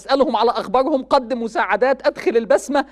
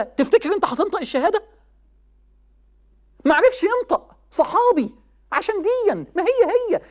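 raised, pitched-up sermon voice; impassioned preaching; 4 semitones higher than usual. Acted. Preacher urging young people, impassioned. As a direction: preach it high and urgent to the crowd. Preacher warns youth about mothers.

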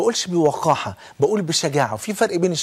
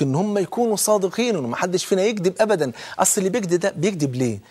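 ordinary delivery

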